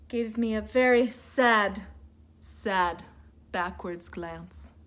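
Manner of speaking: sad. A narrator's reading. Sad story moment.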